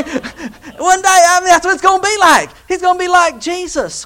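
high-pitched burst of excitement; excited exclaiming. Real, not acted. Preacher tells the congregation what one day will be like, excited exclaiming. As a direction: exclaim it high, carried away with joy. Exults over the coming likeness.

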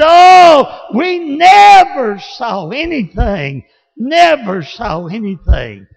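high, loud cry of wonder; amazed shout. Retold or imitated. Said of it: "And they said".

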